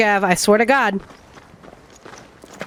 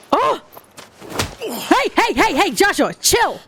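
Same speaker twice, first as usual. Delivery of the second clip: startled; 4 semitones higher than usual. Real, not acted. Streamer reacts to game's sudden turn.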